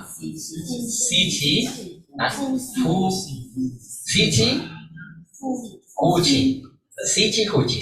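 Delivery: playful Mandarin demo of "si chi fu chi"; Mandarin breathing words. Shown as a demonstration. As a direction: say "si chi" and "fu chi" as spoken Mandarin syllables. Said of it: "How you say in Mandarin".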